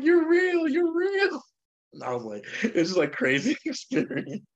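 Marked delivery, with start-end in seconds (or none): 0.0-1.4 very high, louder imitation of astonishment; excited homeless man. Homeless man just punched as proof, imitated in excited amazement. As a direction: exclaim it way up high, imitating his thrill. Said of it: "like, so excited".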